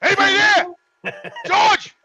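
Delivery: loud, high calling out; yelling into house. Retold or imitated. Calling for George and Sean through the door, loud. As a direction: call out into the empty house. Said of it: "I yell into the house".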